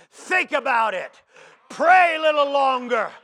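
loud, high, slowed counsel; fatherly preaching at full force. Acted. James advising a son about anger, preached loud. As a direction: urge each line like a father pleading, building.

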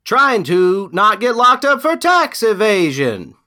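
very high, loud camp singer impression. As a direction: belt it out as a mock singer. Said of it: "in the [name] voice".

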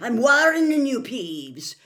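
loud, high warning, dropping on the name; shouted warning. A narrator's reading. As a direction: shout a stern last warning. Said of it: "shouted Professor McGonagall".

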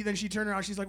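usual speaking voice

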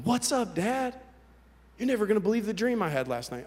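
put-on high, chirpy kid greeting Dad; imagined son. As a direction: play an eager boy with news. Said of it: "I would imagine him waking up".

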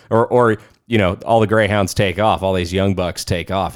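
ordinary talk

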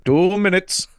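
put-on high, loud silly accent on "Two minutes"; weird accent. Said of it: "that weird accent".

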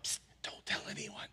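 hushed conspiratorial whisper; mock whisper. Preacher imagining Jesus secretly hushing the crowd, mock whisper. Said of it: "He didn't whisper it".

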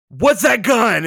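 sudden high-pitched outburst; mock screech of alarm; retold scream. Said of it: "just, like, screaming immediately".

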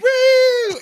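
high, loud mock fan squeal; hammed-up woo of a thrilled woman fan; excited fan woo. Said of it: "she's like".

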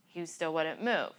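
normal talking voice

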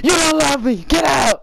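high, hammed-up wounded shout; mock tearful tantrum. Friend playing crying son yelling at mom, high mock shout. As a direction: yell it like a dramatic hurt kid. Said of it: "And I was like".

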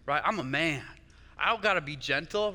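ordinary delivery

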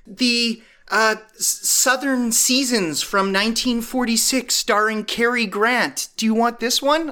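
put-on high, eager old grandma offering a movie; high-pitched granny pitch. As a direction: play the pushy grandma. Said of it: "she's like".

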